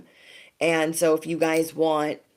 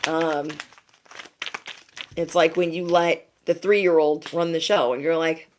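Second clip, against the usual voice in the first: ordinary talk, nothing marked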